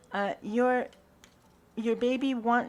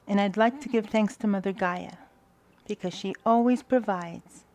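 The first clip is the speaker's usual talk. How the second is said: prayer of thanks; 3 dB louder than usual. Acted. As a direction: pray it out, one blessing at a time.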